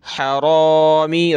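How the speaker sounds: slow chanted हरामी with a rounded "ro"; heavy raa. Shown as a demonstration. Quran teacher drilling the word, heavy raa in हरामी. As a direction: draw out a thick "raa" in हरामी. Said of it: "रा को मोटा करें".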